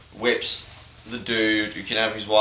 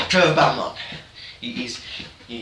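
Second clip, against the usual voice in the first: normal talking voice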